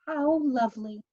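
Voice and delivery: high, happy exclamation; savouring the juice; light happy sigh of delight. A narrator's reading. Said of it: "sucking and saying".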